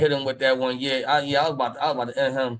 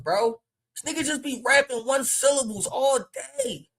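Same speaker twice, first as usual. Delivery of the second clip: high, loud, incredulous-style ranting; heated rant; 9 semitones higher, 6 dB louder than usual. Real, not acted. Battle rap fan complaining about one-syllable rhymes, heated rant.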